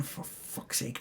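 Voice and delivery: whispered curse under the breath; exasperated whisper thrown away; whispered aside. Real, not acted. Annoyed at Leorora owning the tavern, whispered curse.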